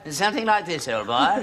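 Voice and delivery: put-on upper-class English, dropping low at the end; upper-class accent. Shown as a demonstration. Old man auditioning as a lord, upper-class accent. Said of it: "speak with an upper-class accent".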